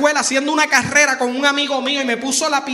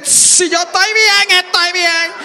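loud, higher urgent plea; begging boy; 7 semitones higher, 9 dB louder, slower than usual. Retold or imitated. Injured boy begging teachers, loud high plea. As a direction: beg them not to call mom.

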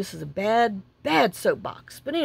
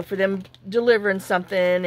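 ordinary delivery

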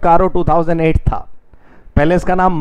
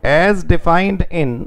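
slow dictation; much slower than usual. Shown as a demonstration. Teacher dictating notes.

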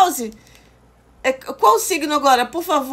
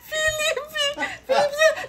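teasing call; 14 semitones higher, 5 dB quieter, slower than usual. Acted.

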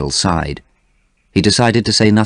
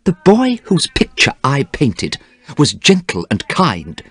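higher-pitched, understated sorrow; sad; 8 semitones higher than usual. A narrator's reading. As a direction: gently mourn the friend he used to be. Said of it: "said Basil sadly".

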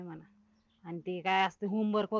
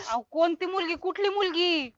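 high-pitched incredulous questions; astonished, high-voiced protest; baffled disbelief; 8 semitones higher than usual. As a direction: call after him, bewildered. Baffled, calls after him.

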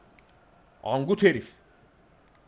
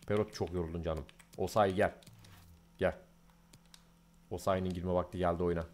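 much softer and lower, calm aside; quiet murmur; 9 semitones lower, 9 dB quieter than usual. Real, not acted. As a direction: say it under your breath while choosing subs. Quietly making substitutions.

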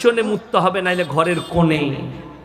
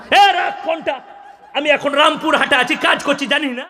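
rude husband; 7 semitones higher than usual. Retold or imitated. Husband brushing off wife's call.

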